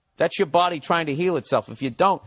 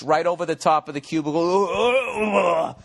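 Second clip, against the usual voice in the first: drawn-out mock orgasm, rising in pitch; 3 semitones higher, slower than usual. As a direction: stretch the last word into a put-on moan. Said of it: "I'll have my fucking O face".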